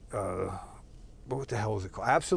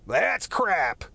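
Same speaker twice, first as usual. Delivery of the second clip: higher, louder, sung-out lightly voiced Morrison on crap; [name] lyric impression; 8 semitones higher, 4 dB louder than usual. Quoting the live album lyric, a lightly voiced Morrison shout. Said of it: "I do it with [name]'s voice in my head".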